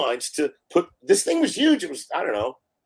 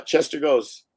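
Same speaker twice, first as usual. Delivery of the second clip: ordinary delivery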